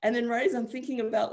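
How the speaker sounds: usual speaking voice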